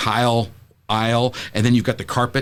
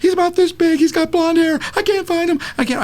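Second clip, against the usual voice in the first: very high, frantic put-on voice; high-pitched mock hysteria; imitating sobbing mother. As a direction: act a hysterical mother answering through panic. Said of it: "sobbing in the middle of the aisle".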